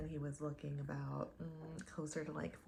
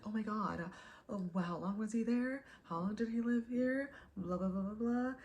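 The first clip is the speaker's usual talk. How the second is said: mock amazed, pitched up; 5 semitones higher than usual. Date amazed her dad lived in New Orleans, mock excited. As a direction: play his starstruck gushing.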